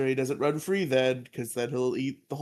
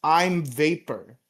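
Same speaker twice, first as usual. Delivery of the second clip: slow, deliberate monster declaration; deadpan villain line; 3 semitones higher, 4 dB louder, much slower than usual. Retold or imitated. Pudgy Pig declaring his power before turning to vapor, slow. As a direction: announce it with grave self-importance. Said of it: "Pudgy Pig just says".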